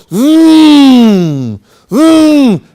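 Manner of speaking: long drawn-out slow "zoom", rising and falling; car engine zoom. Retold or imitated. Describing Tone's car hitting its speed cap, drawn-out engine zoom. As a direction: stretch it like a car straining to speed up. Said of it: "the car go".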